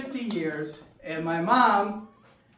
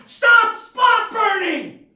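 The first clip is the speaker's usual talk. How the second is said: high, drawn-out frantic yell; screaming; 13 semitones higher, 12 dB louder, much slower than usual. Acted. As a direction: scream it at a friend across the boat. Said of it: "Nico screams".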